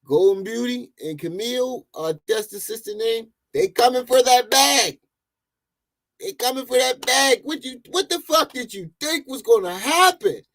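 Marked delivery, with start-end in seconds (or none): 3.5-10.4 heated rant. Real, not acted.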